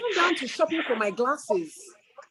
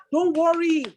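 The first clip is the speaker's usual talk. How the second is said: a lightly voiced, higher, brisk reassurance; imitating Frances; 5 semitones higher, slower than usual. Birthday woman mimicking her friend's cheerful reassurance, higher. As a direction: mimic a friend soothing you in her own way. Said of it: "This is how Frances talks".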